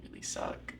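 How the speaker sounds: sussurro sommesso, senza voce; whisper. Real, not acted. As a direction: confida a bassa voce, rassegnata. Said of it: "whisper heard".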